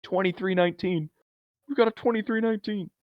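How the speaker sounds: high-pitched cartoon alert; Monsters, Inc. alarm call. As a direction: call out the code in a high movie-quote voice. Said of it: "That's from Monsters, Inc".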